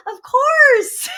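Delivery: high, loud thrilled reply; excited. Retold or imitated. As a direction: gush it out with delight. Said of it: "I was like".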